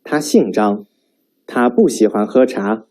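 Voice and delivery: 他姓张 and 他不喜欢喝茶 with falling ends; statement fall; falling statement tune. Shown as a demonstration. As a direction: state both, ends dropped. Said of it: "with falling intonation".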